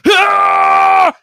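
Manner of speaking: high loud mock wail scream; imitated scream cry. Imitating friend scream-crying at Endgame, loud wail. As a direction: belt out a sobbing scream like his friend. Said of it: "He's screaming".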